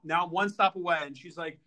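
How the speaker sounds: ordinary delivery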